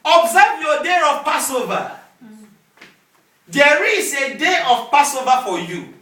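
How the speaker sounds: emphatic sermon proclamation; forceful preaching. Real, not acted. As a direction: proclaim it to the congregation, hitting key words hard.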